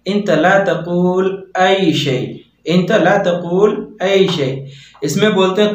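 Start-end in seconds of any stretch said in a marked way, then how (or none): 0.1-4.5 very slow, stress on ला; teacher dictating an Arabic phrase; slow Arabic model. Shown as a demonstration. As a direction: say इंत ला तकूल ऐ शै very slowly, hitting ला. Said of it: "इसको अरबी में बोलेंगे".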